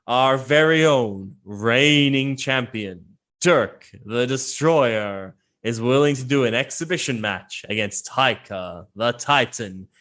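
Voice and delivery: slow, drawn-out ring announcer; showy emcee stretching out names and titles; Spruce Tuffer. Acted. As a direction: build up the champion like a fight promoter. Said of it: "Spruce Tuffer kinda walks out".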